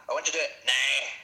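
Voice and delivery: lightly mimicked Northern Irish, fast; imitating Irish accent. Mocking Pryce's accent to co-hosts, Northern Irish mimicry. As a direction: quote Pryce's Irish "now" quickly. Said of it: "he gets the now right".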